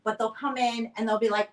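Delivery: usual speaking voice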